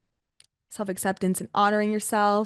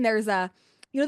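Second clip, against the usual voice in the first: normal talking voice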